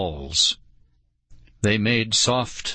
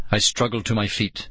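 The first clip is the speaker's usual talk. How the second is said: usual speaking voice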